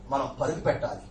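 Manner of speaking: ordinary delivery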